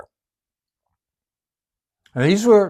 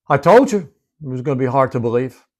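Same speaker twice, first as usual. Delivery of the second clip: normal talking voice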